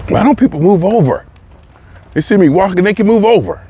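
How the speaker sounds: high, exasperated gripe; irritated at walkers who won't move over; exasperated complaint. Real, not acted. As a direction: vent at the people blocking the path. Annoyed at people on the path.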